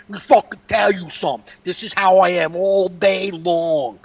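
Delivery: mock New York tough-broad voice; New York accent. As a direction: imitate her New York bragging, drawing out the end. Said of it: "That's how she sa".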